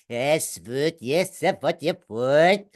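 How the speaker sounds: mock Swedish sing-song; Swedish accent. Shown as a demonstration. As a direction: fake Swedish, as if talking backwards. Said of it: "anyone can do a Swedish accent".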